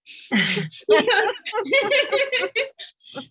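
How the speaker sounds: long, high burst of laughter; real laugh. Real, not acted. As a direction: laugh at the memory. Laughs at own story.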